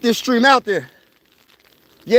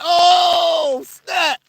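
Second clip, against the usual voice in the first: excited shout; 8 semitones higher, slower than usual. Retold or imitated. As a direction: yell it like a fan jumping off the couch. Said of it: "I was like".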